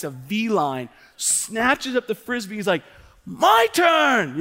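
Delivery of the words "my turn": put-on high, loud kid yell; imitated child's outburst; tantruming little boy. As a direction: shout it like a possessive four-year-old. Dad voices son snatching frisbee.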